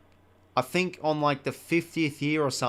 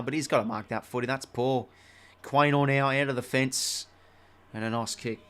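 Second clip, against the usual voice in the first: louder brisk footy commentator call; play-by-play commentary; 5 dB louder than usual. Acted. Gamer calling the final term of his footy match, louder commentary. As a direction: call the last quarter like TV commentary.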